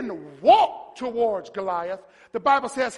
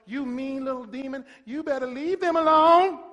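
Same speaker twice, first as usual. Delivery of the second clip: mock exorcism; 2 semitones higher than usual. Preacher mocking how they cast out devils, timid then squeaky. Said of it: "when they cast out devils".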